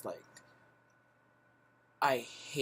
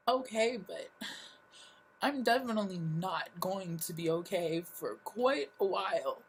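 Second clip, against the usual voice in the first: high, resigned grief; retold heartbreak; 7 semitones higher, 4 dB louder than usual. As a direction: sigh it out high, bracing for loss. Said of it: "And I was like".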